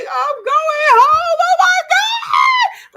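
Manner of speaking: high, drawn-out joyful scream; loud, stretched, overwhelmed shriek; re-enacted screaming. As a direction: scream the news out at full pitch. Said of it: "Like, I'm just screaming".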